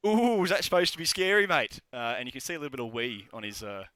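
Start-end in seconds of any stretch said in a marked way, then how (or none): 0.1-1.8 high-pitched mock-scared jeer; mocking taunt. Guard mocking the growling player, taunting. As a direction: scoff at a feeble threat in a raised pitch. Heckler taunts a failed intimidation.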